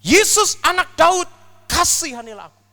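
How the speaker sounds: loud high desperate cry for mercy; crying out in prayer. Acted. Blind beggar shouting to Jesus, loud desperate cry. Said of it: "dia mulai berdoa dan dia berkata".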